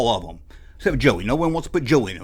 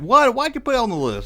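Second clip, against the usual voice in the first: puzzled viewer; 7 semitones higher than usual. Retold or imitated. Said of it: "people probably be like".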